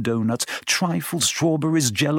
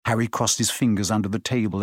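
plain reading voice

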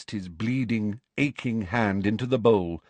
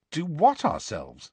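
suspicious; 7 semitones higher than usual. Acted. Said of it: "said Harry suspiciously".

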